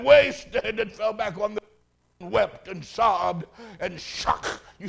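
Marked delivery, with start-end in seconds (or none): 0.0-0.8 retold sob, higher and a bit louder; mock sobbing. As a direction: sob the word out, mildly acted. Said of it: "Then he sobbed and said".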